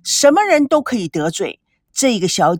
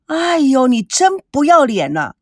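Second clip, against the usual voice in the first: louder, higher, scandalised yelling; furious outburst; 6 semitones higher, 4 dB louder than usual. Acted. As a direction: explode at the insult. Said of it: "孙娜恨不得打他两个耳光". (怒不可遏地骂)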